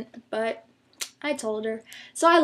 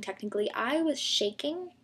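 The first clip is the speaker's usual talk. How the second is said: normal talking voice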